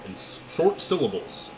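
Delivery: booming race caller, slowed down; generic commentator. Pretend. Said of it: "a generic commentator".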